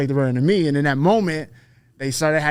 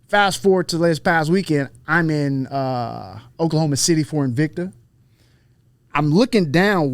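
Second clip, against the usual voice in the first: normal talking voice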